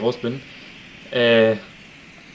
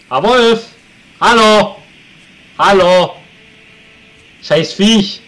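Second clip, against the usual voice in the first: shouting at wolf; 8 semitones higher, 11 dB louder, much slower than usual. Real, not acted. Yelling at attacking wolf.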